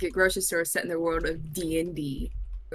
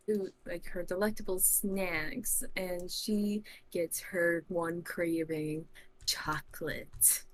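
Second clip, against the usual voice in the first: normal talking voice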